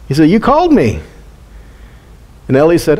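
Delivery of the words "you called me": put-on higher-pitched little boy; Samuel. Boy telling the priest he was called, high child voice. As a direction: lift it into a light kid voice. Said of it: "this little kid".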